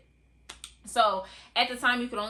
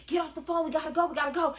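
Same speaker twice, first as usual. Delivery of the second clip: dad shouting high and urgent to hang up; higher mock yell of an impatient dad; retold yelling; 8 semitones higher, much faster than usual. Dad yells at daughter to hang up, urgent shout. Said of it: "My dad's in the background yelling".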